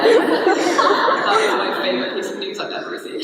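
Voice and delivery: loud, high, drawn-out delight. Real, not acted. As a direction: gush over the best news, laughing. Delighted reaction to accent news.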